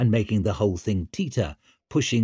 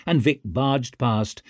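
plain reading voice

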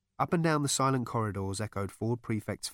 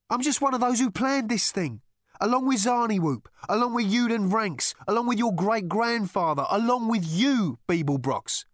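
loud, high insistent listing; heated retort; 13 semitones higher, 6 dB louder than usual. A narrator's reading. Rooster tells Zaphod who planned this thing, heated. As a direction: build the list like an accusation.

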